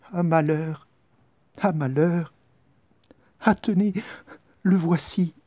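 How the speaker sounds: a frightened attendant spotting the prince; alarmed servant. Acted.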